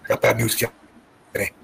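softly repeating the bad news; quiet bad news. Quietly telling Kenny the bad news. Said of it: "That was his exact words".

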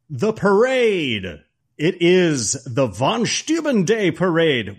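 slow, high, hammed-up announcer; grand announcement. Podcast guest unveiling his favorite scene, grandly announced. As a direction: stretch the title out with mock grandeur.

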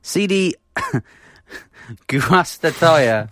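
slow, high, giggling Guastatoya; laughing through it. Real, not acted. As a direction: laugh your way through the name. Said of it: "What was the name again".

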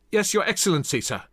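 high-pitched soldier's acknowledgement; dutiful reply. A narrator's reading. Gulping captain answers his general.

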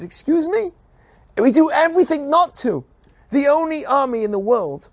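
very high, indignant excuse me; incredulous objection; outraged retort. Retold or imitated. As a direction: protest the slander. Retort to accusers.

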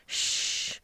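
long, voiceless shushing sound; hushing shh. Retold or imitated. Quoting ma mère quieting Michelle, voiceless hush. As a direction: hush someone with a drawn-out shh. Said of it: "no, she actually says".

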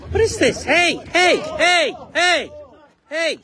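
loud high shouts for attention; shouting. Real, not acted. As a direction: yell it across the road. Yelling for police attention.

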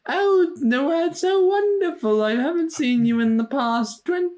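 a hammed-up gushing mother voice. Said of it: "I don't know what this accent is turning into".

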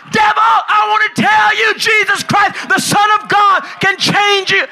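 bullhorn street preaching. Retold or imitated. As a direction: call out to the empty street like a new convert. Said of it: "I grabbed the microphone".

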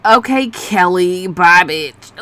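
slow, drawn-out sassy dismissal; sassy brush-off. Retold or imitated. Speaker mocking a boring dating match, slow sassy brush-off. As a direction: wave him off like a diva.

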